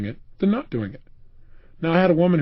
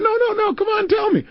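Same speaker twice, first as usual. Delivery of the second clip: high-pitched, teasing coaxing; playful insisting. Retold or imitated. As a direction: press her eagerly in a raised pitch. Acts out his playful insisting.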